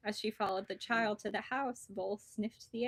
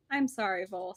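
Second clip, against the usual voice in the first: ordinary delivery, nothing marked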